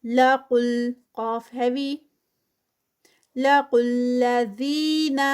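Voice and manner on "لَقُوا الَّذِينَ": Quran recitation. Shown as a demonstration. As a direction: chant each word with its lengthening. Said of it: "زی یہاں پر ٹو counts".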